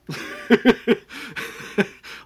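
high unvoiced laughter bursting out. Real, not acted. Telling friends about the shop gasping, laughing hard. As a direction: crack up remembering the scene.

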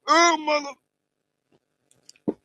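high, strained, drawn-out gasp of words; words squeezed out while choking. Real, not acted. Choking on a drink mid-podcast, strained high. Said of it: "I got choked".

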